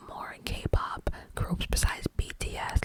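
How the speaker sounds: ordinary talk, nothing marked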